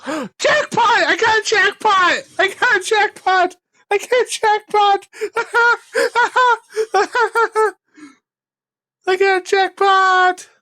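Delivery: high, repeated, delighted chant; gleeful jackpot cry. Real, not acted. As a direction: crow about the jackpot over and over. Gleeful after hitting jackpot.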